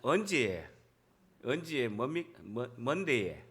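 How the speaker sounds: tentative, low Pohang accent; groping attempt at Pohang speech; Pohang dialect. Shown as a demonstration. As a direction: feel out a Pohang lilt. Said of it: "포항 사투리 잘 모름이라고".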